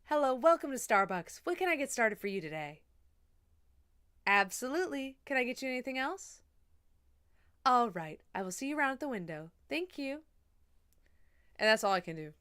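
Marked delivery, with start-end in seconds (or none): 0.0-10.2 higher, polite drive-thru greeter; Starbucks customer-service voice. Shown as a demonstration. Barista taking an order at the drive-thru, customer-service voice. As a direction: put on a chirpy, lilting service voice. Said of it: "Let me lock it into my Starbucks".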